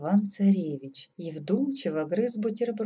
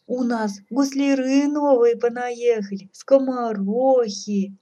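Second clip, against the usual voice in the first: high, loud coaxing lament; 6 semitones higher, 8 dB louder, slower than usual. A narrator's reading. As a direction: coax your son with a drawn-out wail. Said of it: "запричитала царица". (заманивая, с причитаниями)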